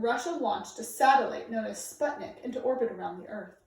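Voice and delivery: ordinary delivery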